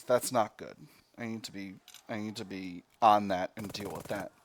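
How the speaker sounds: normal talking voice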